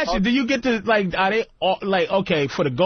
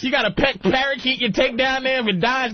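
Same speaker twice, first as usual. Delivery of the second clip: ordinary talk, nothing marked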